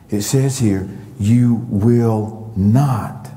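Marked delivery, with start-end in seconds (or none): 1.2-3.3 "You will not" very slowly, word by word; separate, weighty beats on "You will not"; stress on each word. Shown as a demonstration. Stressing words of quoted verse.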